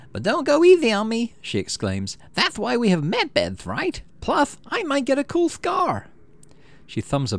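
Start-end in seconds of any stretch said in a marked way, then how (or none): 0.1-1.3 high, loud, raring to go; a tough kid daring you to hit hard; eager Enemy exclaiming. Acted. Enemy daring you before sports ball, high and loud. 2.3-6.1 high, slow put-on voice, relishing it; gleeful Enemy. Enemy joking to you about med beds, high gleeful voice.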